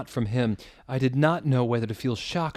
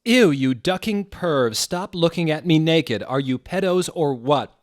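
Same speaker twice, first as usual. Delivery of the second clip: screamed; 6 semitones higher, 6 dB louder than usual. Acted. Ebony screaming at the spies outside her window. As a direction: yell at the peeping creeps in disgust. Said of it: "I screamed".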